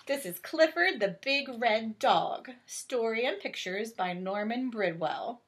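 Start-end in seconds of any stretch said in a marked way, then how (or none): none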